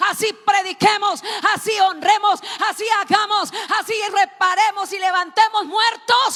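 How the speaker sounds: loud, high shouting sermon; preaching at full force. Acted. Preacher shouting Jesus's cry from the cross, full force. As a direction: cry it out at the top of your voice.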